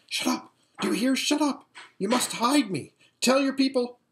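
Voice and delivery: urgent, high-pitched hiss; furious whisper. A narrator's reading. Aravis silencing Lasaraleen in the litter, whispering furiously. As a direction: order it sharply into a friend's ear. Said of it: "whispered furiously in her ear".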